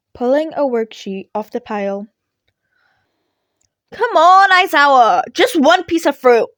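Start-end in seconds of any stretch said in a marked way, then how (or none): 3.9-6.5 high, loud pleading whine; begging. A narrator's reading. Midoriya begs his teacher for one fruit, whining loudly. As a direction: beg like it's physical agony. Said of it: "Midoriya begged".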